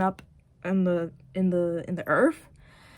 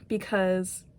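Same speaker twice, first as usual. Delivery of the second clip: ordinary delivery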